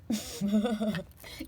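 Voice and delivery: bright burst of laughter; real amused laugh. Real, not acted. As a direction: laugh at the peeved angel. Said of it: "And I'm laughing because".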